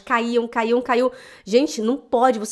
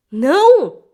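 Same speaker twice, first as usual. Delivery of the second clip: emphatic no; 4 semitones higher, 9 dB louder than usual. Real, not acted. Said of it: "emphatic refusal".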